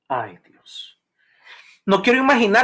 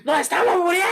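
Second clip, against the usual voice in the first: high, loud desperate plea; mock terrified disciples shouting; panicked cry; 13 semitones higher, 4 dB louder than usual. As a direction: disciples in the storm crying out to Jesus in panic. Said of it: "Yo me los imagino".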